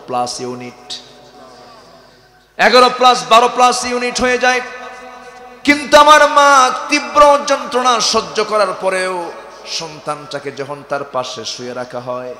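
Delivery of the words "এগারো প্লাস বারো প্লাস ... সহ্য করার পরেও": loud, high, fervent preaching; loud impassioned sermon; full-force preaching. Acted. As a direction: drive it home to the crowd like a waz preacher.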